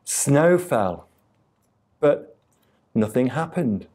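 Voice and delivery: usual speaking voice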